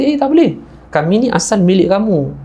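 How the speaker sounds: high-pitched, protesting rebuff; sharp rise in pitch on a mock refusal; acted refusal. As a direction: imitate a maiden warding someone off. Heavenly maiden refuses rich man's touch.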